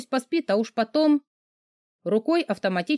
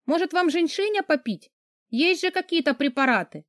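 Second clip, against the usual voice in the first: higher, sugary fake sympathy; mocking young woman; 7 semitones higher than usual. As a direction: needle him with sweet mock pity. Heroine mocks boss.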